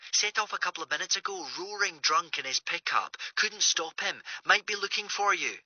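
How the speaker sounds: small, faraway voice down a phone line; Greer on the phone. Acted.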